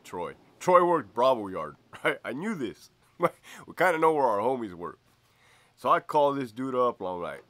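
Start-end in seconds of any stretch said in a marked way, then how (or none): none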